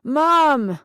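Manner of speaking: put-on drunken bellow; yelled out. A narrator's reading. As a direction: yell for someone downstairs. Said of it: "Jason yelled out".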